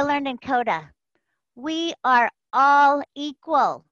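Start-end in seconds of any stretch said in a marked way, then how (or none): 1.5-3.8 slow, deliberate chant of the creed; slow mantra. Shown as a demonstration. Leading the call in a slow unison mantra. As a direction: lead the group, spacing each word. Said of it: "Say it with me".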